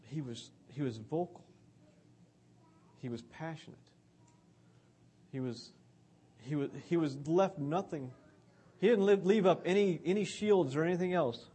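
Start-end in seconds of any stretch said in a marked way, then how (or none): none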